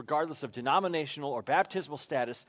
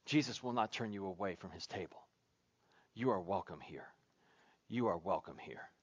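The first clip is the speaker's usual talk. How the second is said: softly, low and hushed; tender reassurance; 7 semitones lower, 7 dB quieter than usual. Real, not acted. Preacher telling worshippers they won't be turned away, gentle and hushed. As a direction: let the welcome sink in, low and soft.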